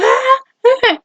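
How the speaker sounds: mock pained cries. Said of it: "She's like".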